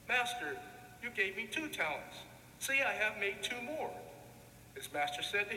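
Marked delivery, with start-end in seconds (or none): none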